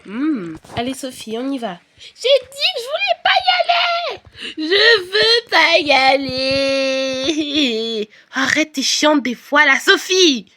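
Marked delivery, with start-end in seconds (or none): 2.0-8.1 very high slow shouted wail; crying toddler. Pretend. Toddler Sophie shouts and cries. 8.3-10.5 louder, slow exasperated scolding; older sister fed up with crying toddler; annoyed big sister. Pretend. [name] yells at toddler sister.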